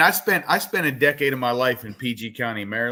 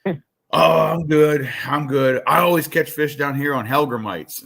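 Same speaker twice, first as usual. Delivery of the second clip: loud, raised voice for the stranger; higher, louder retold stranger's voice; cracked-out stranger; 5 semitones higher, 6 dB louder than usual. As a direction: act out an over-amped homeless guy. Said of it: "And he's like".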